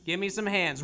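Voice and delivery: usual speaking voice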